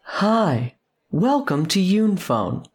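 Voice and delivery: loud, high-pitched advert voice; commercial announcer. Acted. Phone company advertising to a whole town, loud high announcer. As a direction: project it like a giant broadcast advert. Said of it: "they all sort of shine this commercial".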